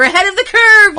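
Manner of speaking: loud, high cheer; excited shout. Real, not acted. Excited exclamation.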